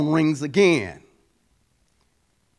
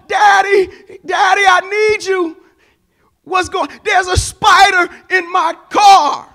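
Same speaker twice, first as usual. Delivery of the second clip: high, loud frightened cry; panicked shout; 12 semitones higher, 9 dB louder than usual. Retold or imitated. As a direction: shout it like a scared girl seeing a spider. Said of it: "she's exasperated".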